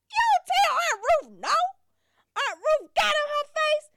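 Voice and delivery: high, loud, slow disbelief; mock outrage. Teller mocking cousin's nerve, high outraged disbelief. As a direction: act shocked anyone would refuse her.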